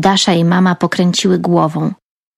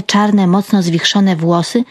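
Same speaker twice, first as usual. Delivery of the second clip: ordinary delivery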